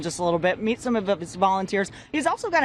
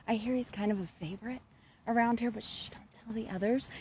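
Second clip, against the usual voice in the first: hushed aside; 10 dB quieter than usual. Real, not acted. Secret aside to the audience.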